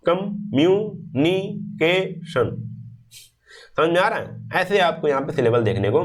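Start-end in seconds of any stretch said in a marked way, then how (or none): 0.1-2.6 "communication" slowly split, a pause after each syllable; pausing between syllables. Shown as a demonstration. Teacher shows where pauses fall, "communication" syllable by syllable. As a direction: break "communication" into five separate beats. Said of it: "कितनी बार आपको pause लेना पड़ा".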